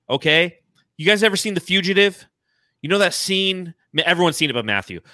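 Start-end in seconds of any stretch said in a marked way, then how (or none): none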